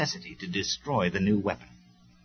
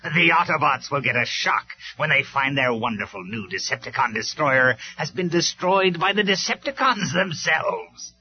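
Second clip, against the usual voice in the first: gloating villain; 5 semitones higher, 5 dB louder than usual. A narrator's reading. Megatron gloating to his warriors about the Autobots. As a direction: savour the enemy's shock.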